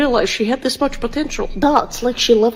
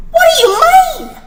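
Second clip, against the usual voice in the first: much higher, louder mock Australian outrage; shocked shout; 7 dB louder than usual. Aussie son shocked that mum left, in a mock Australian shout. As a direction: yell it in disbelief.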